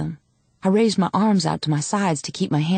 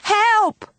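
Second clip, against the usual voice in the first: very high, long cry; scream for help. A narrator's reading. Girl held by policeman, screaming for help. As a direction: scream out for rescue. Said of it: "I screamed".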